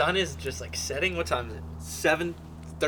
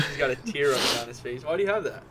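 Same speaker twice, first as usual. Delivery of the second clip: normal talking voice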